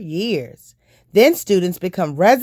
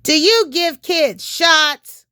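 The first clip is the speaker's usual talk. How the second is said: put-on higher and slow, a wary kid; child's voice; 9 semitones higher, slower than usual. Paul asking the doctor about shots, slow higher child voice. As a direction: ask it like a small worried boy.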